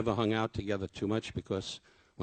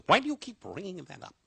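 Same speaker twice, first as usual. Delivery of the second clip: lightly voiced irritated Don, high; a hint of Don's testy comeback; mock Don; 11 semitones higher, 5 dB louder than usual. Don snapping back at his friend, mildly mimicked.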